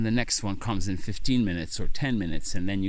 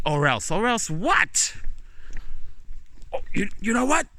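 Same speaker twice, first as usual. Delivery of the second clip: indignant shouting; 13 semitones higher than usual. Acted. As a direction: scoff at the threat. Quitting fight with the boss.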